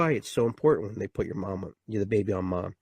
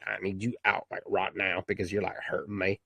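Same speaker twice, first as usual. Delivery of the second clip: softer, strained mom voice; mock a mother in labour, hushed; mother's plea; 5 semitones lower, 5 dB quieter than usual. Mom in labour telling the baby to come out, quiet and strained.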